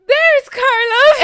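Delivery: high, drawn-out holler; loud retold shriek of discovery; excited shout. Friend calling out that she found Carlos, excited shout.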